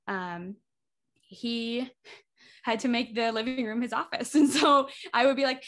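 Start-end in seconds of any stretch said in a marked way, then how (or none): none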